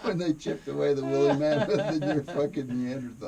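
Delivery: loud laugh through the words. Real, not acted. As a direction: laugh while telling the punchline. Laughing at his own joke.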